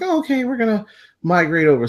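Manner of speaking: lightly voiced meek owners giving way; mock dog owners. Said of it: "all the owners like".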